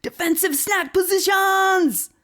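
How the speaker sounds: high, loud panicked battle cry; frantic pet shouting a defense drill; shouted alarm. A narrator's reading. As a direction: yell the order like a tiny commander. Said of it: "fell out ... and shouted".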